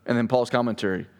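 ordinary delivery

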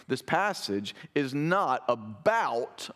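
about slowed and leaned on; slow down and weigh the word about; This passage is not about, with about drawn out and stressed. Shown as a demonstration. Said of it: "I'm emphasizing the word about".

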